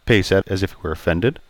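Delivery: plain reading voice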